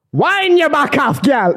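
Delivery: high, drawn-out joke holler; mock chat-up call. Joking about how they asked their partner out, hammed-up drawn-out call. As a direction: play it up with a long stretched WIND.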